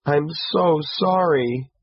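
high, slow, understated tearful apology; soft sobbing. A narrator's reading. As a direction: apologize as if softly crying. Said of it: "she sobbed softly".

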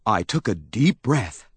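ordinary delivery

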